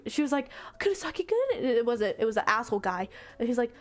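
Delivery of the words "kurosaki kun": put-on high, questioning girlish call; sweet surprised query, pitched way up; hopeful girl. Girl thinking Kurosaki has come, hopeful and high.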